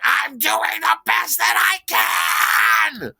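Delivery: high, drawn-out full scream; loud, stretched-out frazzled shriek; screaming back. Retold or imitated. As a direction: yell back at the chef in desperation. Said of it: "I screamed back at him".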